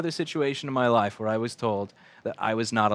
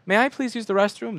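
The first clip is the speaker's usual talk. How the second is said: 1 polite pupil asking; 9 semitones higher, 4 dB louder than usual. Retold or imitated. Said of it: "You raise your hand and go".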